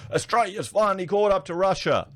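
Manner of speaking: high, sarcastic headline voice; mock media claim. Mocking what the media tells Australians, sarcastic high tone. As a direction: parrot the media claim with scorn. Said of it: "You know how the media is saying".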